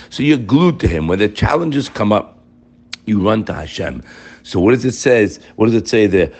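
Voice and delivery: ordinary talk, nothing marked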